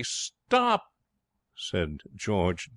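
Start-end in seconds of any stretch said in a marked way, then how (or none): 0.0-0.8 very high, urgent yelp; sudden panicked cry; alarmed cry. A narrator's reading. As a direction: desperately halt someone. George panics before the capsize.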